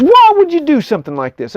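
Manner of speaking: king scolding Isaac, high-pitched and indignant. Retold or imitated. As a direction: tear into him like a furious old king. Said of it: "He says".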